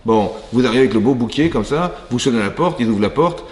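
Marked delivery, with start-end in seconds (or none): none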